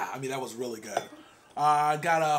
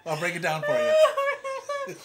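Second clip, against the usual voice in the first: mock-boastful laughing; 4 dB louder, much slower than usual. Joking about his six pack.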